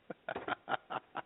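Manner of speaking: soft, breathless laughter; helpless quiet laughing fit; trailing, voiceless giggle. Real, not acted. Cracks up over the name.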